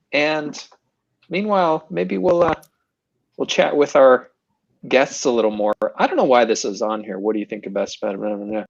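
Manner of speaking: ordinary talk, nothing marked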